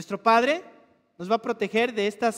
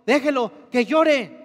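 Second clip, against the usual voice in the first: firm teacher; 3 semitones higher, 7 dB louder, slower than usual. Retold or imitated. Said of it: "nos dijo".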